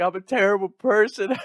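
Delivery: high, loud self-pitying mock wail; mock crying. Son confessing to his parents, mock crying. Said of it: "I'm crying to my parents".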